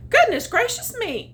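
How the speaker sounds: high, loud startled exclamation; high startled cat voice; exclaiming cat. Acted. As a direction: gasp it out in shock. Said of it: "exclaimed the cat".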